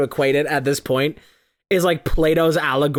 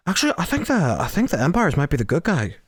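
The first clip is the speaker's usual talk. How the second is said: pretentious film student voice; faster than usual. Retold or imitated.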